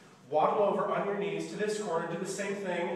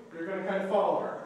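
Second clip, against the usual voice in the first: ordinary talk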